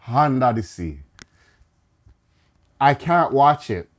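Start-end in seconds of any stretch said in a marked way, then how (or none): 0.1-1.0 "under the sea" said as "hunda di si" in Jamaican patois; Jamaican accent. Shown as a demonstration. Fan shows how the song should sound, in a Jamaican accent. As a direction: drop the th and add an h: "hunda di si". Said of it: "instead of".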